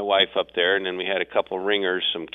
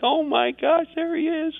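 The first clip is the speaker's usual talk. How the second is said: imitated amazed kids. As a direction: imitate wide-eyed kids spotting Santa. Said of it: "they're like".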